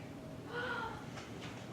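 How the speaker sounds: gasp. Acted. Puck discovers the sleeper.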